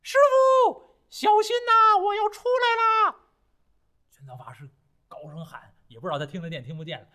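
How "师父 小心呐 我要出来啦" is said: high, loud monkey cry; monkey calling. A narrator's reading. Monkey calling his master from under the mountain, high and loud. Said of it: "这猴子喊".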